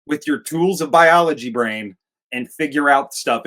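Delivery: ordinary delivery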